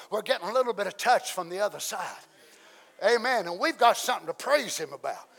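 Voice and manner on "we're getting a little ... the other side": normal talking voice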